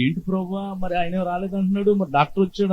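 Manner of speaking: hushed wonder. Retold or imitated. Mother asking God, puzzled.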